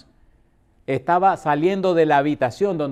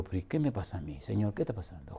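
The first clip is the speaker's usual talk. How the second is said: hushed plea; 7 semitones lower, 16 dB quieter than usual. Retold or imitated. Pastor's anxious private prayer.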